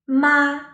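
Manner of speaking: high, level "ma", firmly sustained; "ma" held on one high note; first tone. Shown as a demonstration. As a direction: keep "ma" high and unwavering. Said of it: "typically high and leveled".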